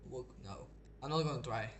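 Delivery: normal talking voice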